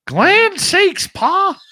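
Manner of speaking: high, twangy hillbilly bear; hillbilly cartoon voice. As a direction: squeeze it up into a backwoods cartoon bear. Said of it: "Hillbilly Bears".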